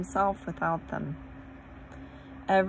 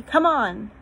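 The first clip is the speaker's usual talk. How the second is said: loud, high urging; urgent call; 5 semitones higher, 7 dB louder than usual. A narrator's reading. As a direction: hurry her friend along. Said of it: "she turned, pulling Susan".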